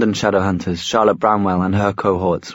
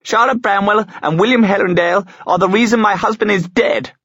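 high furious shout; 11 semitones higher than usual. A narrator's reading. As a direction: hurl the accusation, shouting. Widow accuses, shouting.

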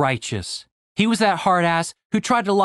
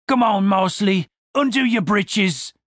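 higher, slow soldier voice; 5 semitones higher than usual. Acted. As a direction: coax his partner nervously. Yellowteeth voiced.

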